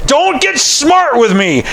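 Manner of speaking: high, slow scolding warning; stern warning. Retold or imitated. Father warning a teenager who talks back, stern scold. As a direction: scold a back-talking teen. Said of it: "I could respond like".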